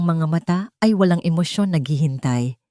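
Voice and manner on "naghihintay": plain reading voice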